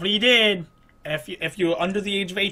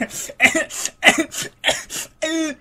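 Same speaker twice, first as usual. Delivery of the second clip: laughter in high bursts; high wheezing laugh; 8 semitones higher than usual. Real, not acted. Player laughing at a failure, helplessly. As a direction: laugh in short, high gasps.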